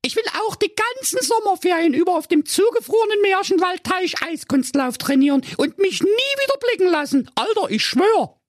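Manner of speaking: put-on very high, whiny pleading girl's voice. A narrator's reading. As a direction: beg for the phone like a weepy teenager. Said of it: "fing Kathi Wittchen an zu weinen und sprach".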